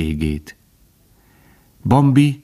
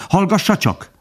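much higher, eager exclamation; excited cry; 12 semitones higher than usual. A narrator's reading. Bambi urges the old stag to listen, excited. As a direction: urge the listener excitedly, pitched high. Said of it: "kiáltott Bambi izgatottan".

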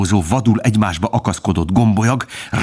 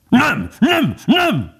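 very high, yelping refusal; howling dog; much slower than usual. A narrator's reading. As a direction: yap it out in a piercing pitch. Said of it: "üvöltötte a kutya". (üvöltő kutyahangon)

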